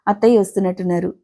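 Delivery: usual speaking voice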